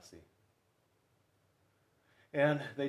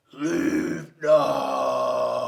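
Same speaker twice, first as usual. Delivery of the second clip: loud, gruff bellow from the bear; grumpy bear shout; 12 dB louder than usual. Acted. Bruce the bear yells at the goslings to leave. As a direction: bark the order to clear off. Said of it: "became extra grumpy".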